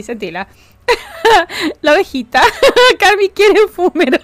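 ordinary delivery

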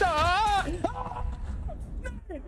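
drawn-out protest climbing into a high wail; desperate outburst. Real, not acted. Streamer protesting to the game, desperate wail. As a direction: stretch the denial until it breaks into a long high cry.

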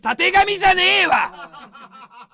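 slow, loud, dragged-out retort with laughter; laughing yell. Real, not acted. Streamer yelling at a viewer who praised his mane. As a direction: stretch out the outrage.

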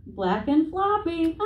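awkward answer. Retold or imitated. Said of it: "That wasn't the right answer".

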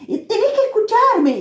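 high-pitched, exasperated retold call; reclamo desesperado imitado. Retold plea, salgo como loca. (reclamo agudo y exasperado)

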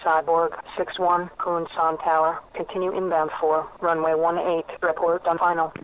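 tower controller on radio; filtered tower clearance, routine; over a radio. Real, not acted.

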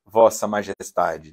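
mock deference. Snubbed person granting the other leave, slow sarcastic deference. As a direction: drag it out with sarcastic pomp.

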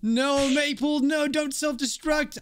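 high, drawn-out cry of protest; slow, high dramatic pleading; desperate acted plea. Voices desperate plea to self-destructing Maple.

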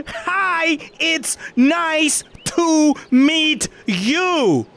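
very slow, high, carefully spelled out; over-enunciate each word slowly; clear and concise. Shown as a demonstration. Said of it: "clear and concise".

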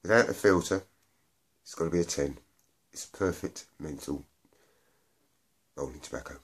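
talking around a cigarette; cigarette at lips. Real, not acted. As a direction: lips partly closed on cigarette. Seen 0: Hand holds cigarette at his lips throughout the labelled words.

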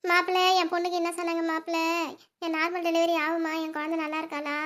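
tearful. Acted. Mother-in-law asks after daughter in labour, tearfully. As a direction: wail the questions as if weeping. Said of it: "நீங்க எதுக்கு அத்தைங்க அழுவுறீங்க".

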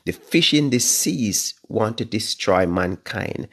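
ordinary talk

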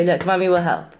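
ordinary talk, nothing marked

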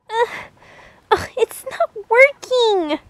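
whiny near-sob over the fire; frustrated whimper. Acted. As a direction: whimper it out, close to tears. Fire won't light, frustrated whine.

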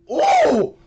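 loud, very high "Ooh!"; wincing high-pitched ooh. Real, not acted. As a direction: squeal an "Ooh!" at the replay. Reaction to play.

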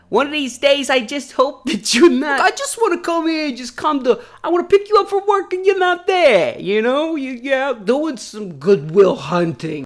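loud, high hammed-up Boston movie voice; overdone Boston yelling; bad Boston accent. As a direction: play a Good Will Hunting scene in a bad accent. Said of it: "I'm gonna do a scene from it".

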